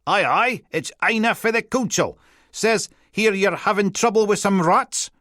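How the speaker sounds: put-on high, loud Highland Teuchter accent; broad Teuchter accent. A narrator's reading. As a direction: lay on a thick Teuchter accent. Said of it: "put on her broadest Teuchter accent".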